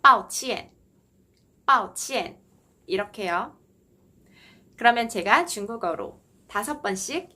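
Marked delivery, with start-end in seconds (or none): 0.0-2.4 抱歉 with both syllables dropping sharply; 抱歉, bao and qian each falling fourth tone; two falling tones. Shown as a demonstration. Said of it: "떨어뜨려서 읽어주시면 됩니다".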